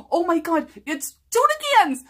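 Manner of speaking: mock-dramatic outcry; horrified discovery. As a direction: shriek at finding the stinking tuna cans. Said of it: "I'm like".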